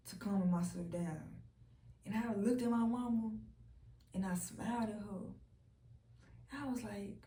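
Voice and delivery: ordinary delivery, nothing marked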